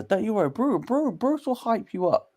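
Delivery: high-pitched imitation of a hype-up; mock hyping up. Commentator imitating the fighter hyping up Bruce, high mock pep talk. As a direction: hype him up in a high put-on voice. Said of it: "when she was hyping up Bruce".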